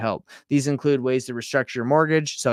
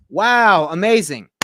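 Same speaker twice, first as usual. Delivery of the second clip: impressed; 5 semitones higher, 7 dB louder, slower than usual. Real, not acted. Reacting to generated message.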